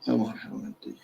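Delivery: breathy whispered aside; whispering on the phone. Real, not acted. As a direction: murmur it away from the mic. Phone call interrupting the lecture.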